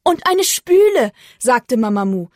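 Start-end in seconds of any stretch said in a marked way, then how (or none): 0.0-1.1 high, loud, amazed exclamation; very impressed. A narrator's reading. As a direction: exclaim it, wowed by the find. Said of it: "Sie war sehr beeindruckt". (tief beeindruckt, hoch ausgerufen)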